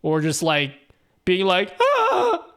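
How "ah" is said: short high-pitched yell; imitated sleep yell, high and held; demo yell. Shown as a demonstration. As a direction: let out a sudden, much higher "ah". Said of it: "Like, that kind of yell".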